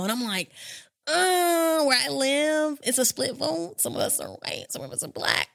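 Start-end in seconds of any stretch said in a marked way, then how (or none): 1.1-3.8 high hesitant. Retold or imitated. Hesitant self-quote. 3.8-5.5 low, whispered aside; whispered confidential aside; hushed aside. Retold or imitated. Hushed aside about the neighbors.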